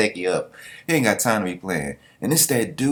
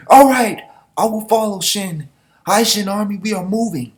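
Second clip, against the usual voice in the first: commanding general; 12 semitones higher, 6 dB louder than usual. Acted. Voicing an anime general's command.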